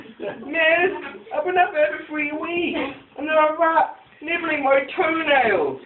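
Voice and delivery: put-on high, pitiful child's complaint; pleading prisoner kid. Child strung up in school prison begging the teacher, high pitiful plea. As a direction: whimper it up like a kid stuck on the wall for weeks.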